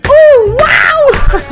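screaming wow in delight; excited scream. Real, not acted. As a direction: yell with pure hype. Reacting to face cam news.

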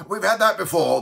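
loud, high indignant interruption. Acted. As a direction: object crossly at full voice. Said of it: "the bellman indignantly said".

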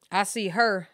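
ordinary talk, nothing marked

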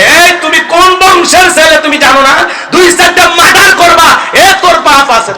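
high, loud bullying shout; egging-on father. Acted. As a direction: goad your child on at full volume. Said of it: "ছেলে মেয়েদেরকে উৎসাহ দেয়".

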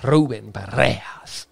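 Ruben Parejas with trilled r, slowly; rolled r. Shown as a demonstration. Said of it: "I like saying his name".

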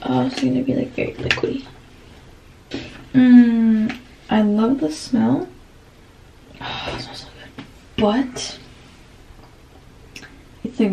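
ordinary delivery

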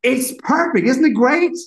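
high, loud mock gushing diva voice; high cheerful impression of the singer. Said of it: "she's like".

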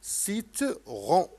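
سيترون drawn out slowly, syllable by syllable; slow sounded-out word. Shown as a demonstration. Teacher modelling a word to a child, slowly drawn out. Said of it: "نستمع".

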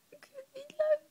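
high whispered whine; whiny whimper. Real, not acted. (jammerend fluisterend)

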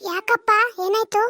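a mother's slow call. Acted. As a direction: answer your son as his old mother. Mother answers son's call.

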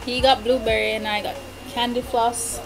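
usual speaking voice

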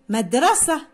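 مدرسة ending in h, no t sound; final ta dropped. Shown as a demonstration. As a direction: say مدرسة as madrasah. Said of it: "يعيد نطق الكلمة مرة أخرى بحذف". (نطق مدرسة بحذف التاء: مدرسه)

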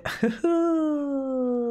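high villain's laugh; evil laugh. Acted. Player laughing villainously at enemy reinforcements. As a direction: gloat with a cackle.

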